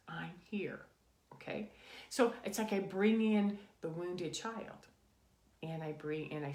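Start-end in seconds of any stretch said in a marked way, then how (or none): 0.1-1.7 soft comforting whisper of I'm here. Shown as a demonstration. Adult reassuring her inner child, whispering. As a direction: breathe it close, like a hand on her shoulder. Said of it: "and I'm standing close".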